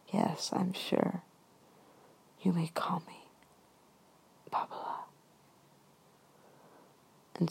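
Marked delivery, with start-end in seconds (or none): 0.1-5.1 whispering. Retold or imitated. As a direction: breathe it out quietly. Grandma's soft answer.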